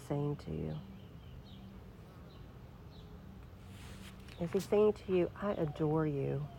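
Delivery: soft, hushed meditation voice; guided prayer. Acted. Pastor guiding the congregation in silent prayer, hushed. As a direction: lead them gently into stillness. Leads a quiet listening prayer.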